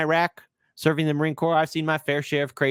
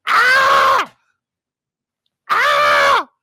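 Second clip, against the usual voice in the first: high hammed-up scream, much louder; mock scream; 10 dB louder than usual. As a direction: fake panicked shriek. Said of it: "When I go crazy here".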